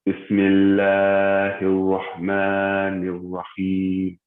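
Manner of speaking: very slow Quran recitation, long vowels held level. Shown as a demonstration. Teacher showing the class the timing, slow drawn-out recitation. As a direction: recite slowly, stretching each long vowel on a steady note. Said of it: "I said it a little bit slowly".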